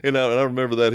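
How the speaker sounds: ordinary talk, nothing marked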